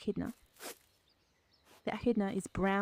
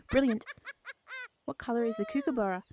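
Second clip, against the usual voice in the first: usual speaking voice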